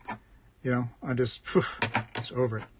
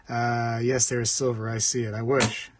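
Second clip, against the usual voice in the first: ordinary delivery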